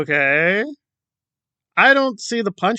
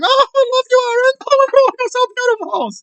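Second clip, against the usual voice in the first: very high, louder, adoring mock voice; mocking Kelly; 4 dB louder than usual. Commentator parodying Kelly hugging Aaron, high adoring coo. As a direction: coo at him like a smitten fan. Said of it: "you literally hugged him".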